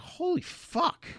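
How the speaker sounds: drawn-out, high, hushed disbelief; retold shock. Friend retells hearing about the dirty coach, stunned. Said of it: "I was like".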